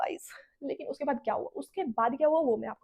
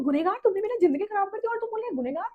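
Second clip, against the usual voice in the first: much higher, indignant rising question; outraged disbelief; 8 semitones higher, 3 dB louder than usual. Retold or imitated. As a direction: throw his own word back at him in shock. Confronting boyfriend who cheated.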